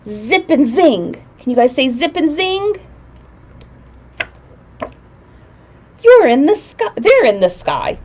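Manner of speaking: put-on lilting kids' show host voice; singsong puppet show host. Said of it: "reading magic words book to kids".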